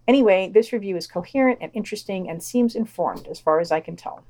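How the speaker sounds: normal talking voice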